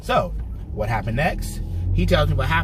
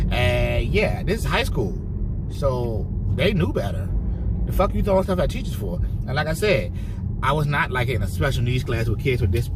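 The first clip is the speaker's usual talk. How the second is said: ordinary talk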